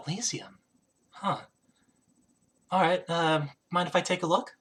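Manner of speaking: hushed, pausing queen; queen character. Acted. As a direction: react quietly, taking time between phrases. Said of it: "she's like".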